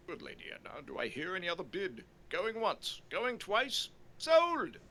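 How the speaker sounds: lightly voiced auctioneer, rising to sold. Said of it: "We'll start the bidding".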